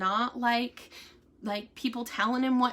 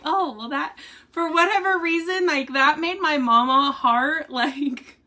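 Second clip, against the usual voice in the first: high, louder, giggly gushing; gushing mama heart; 6 semitones higher, 5 dB louder than usual. Retold or imitated. Wife tells nurse her mama heart is glad, gushing. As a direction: bubble over with fond delight. Gushing over husband's weight news.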